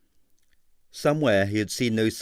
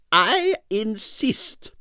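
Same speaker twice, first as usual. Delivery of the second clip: slow, high, final insistence; imperious ballerina; 11 semitones higher, much slower than usual. A narrator's reading. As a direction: lay it down as law, slowly. Arienskaya's firm insistence.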